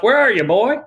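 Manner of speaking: put-on high, loud shout for the boy; father shouting. Father searching the bathroom for his son, loud high shout. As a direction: call out loudly and high, searching. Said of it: "And he shouts".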